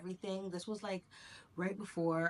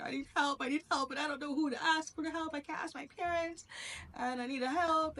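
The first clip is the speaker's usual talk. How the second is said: desperate plea; 9 semitones higher, 6 dB louder than usual. Retold or imitated.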